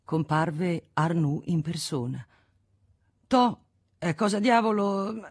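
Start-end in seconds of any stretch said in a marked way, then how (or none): 3.3-5.2 annoyed. A narrator's reading. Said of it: "col viso paonazzo e l'aria scocciata".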